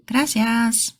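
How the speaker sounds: usual speaking voice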